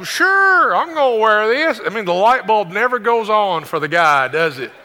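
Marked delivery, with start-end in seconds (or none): 0.0-1.8 high, loud, oblivious reply; clueless husband. Acted. Husband answering his wife's loaded question, high loud cluelessness. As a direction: answer brightly, missing the hint entirely. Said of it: "You go".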